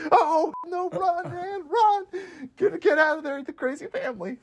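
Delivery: high frantic warning to the screen; mock panicked audience. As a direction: yell at the hero to flee, hammed-up.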